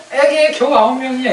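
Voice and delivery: high, loud exclamation of mock disbelief; mimicked dismay. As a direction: imitate someone scoffing at a tiny turnout. Quotes a member's disappointed reaction.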